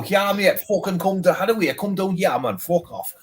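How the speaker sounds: put-on rough old Geordie brushing off a heckler; hammed-up Geordie accent, pitched high. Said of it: "I'd probably go an old Geordie and go".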